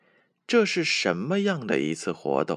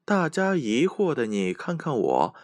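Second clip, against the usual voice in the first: plain reading voice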